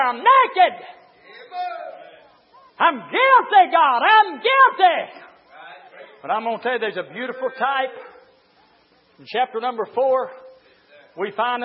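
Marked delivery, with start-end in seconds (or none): 0.0-5.2 loud, high cry of confession; preacher acting a guilty sinner; Adam confessing. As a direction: holler out the guilt to God.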